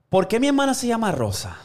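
put-on high-pitched kid's question; curious child. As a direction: ask it like a little kid. Child asks father in joke.

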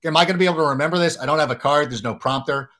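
fast, mildly panicked self-talk; rushed anxious thought. Retold or imitated. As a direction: race through the worry. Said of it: "I would be so frightened".

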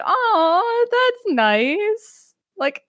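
high sing-song cute voice; mock cooing. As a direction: coo over something adorable. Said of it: "everybody's like".

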